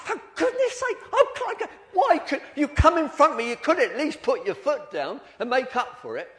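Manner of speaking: high, loud, fed-up shout at a bad driver; exasperated outburst. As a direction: vent like you're stuck behind a slowcoach. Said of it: "I was really cross".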